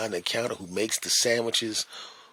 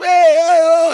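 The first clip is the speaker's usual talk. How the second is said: very high, very loud cries; excited running greeting; 11 dB louder, slower than usual. Retold or imitated. Worker rushing up to greet old friend, very high and loud. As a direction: burst in with joy. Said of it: "guy comes running up".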